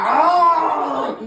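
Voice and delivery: long, high, loud mock yell; re-enacted pain scream. Storyteller re-enacting dog biting his arm, long mock scream. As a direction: yell as if bitten, hammed up.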